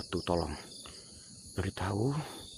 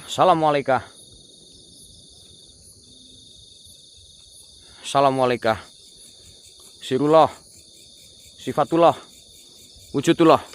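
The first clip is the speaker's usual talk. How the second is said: calling out to spirits; 4 semitones higher, 9 dB louder than usual. Real, not acted.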